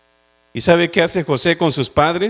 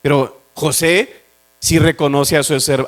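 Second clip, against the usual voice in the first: ordinary delivery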